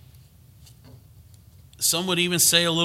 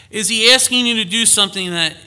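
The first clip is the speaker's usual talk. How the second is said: urgent preacher appeal; 6 semitones higher, 9 dB louder, faster than usual. Real, not acted.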